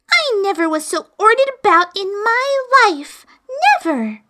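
put-on high, put-out girl's voice; indignant Alice. Alice grumbling to herself about being ordered about. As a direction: huff at being bossed about.

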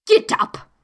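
high piercing royal command; sudden loud, shrill queen. A narrator's reading. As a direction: shriek it as an order. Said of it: "in a shrill, loud voice".

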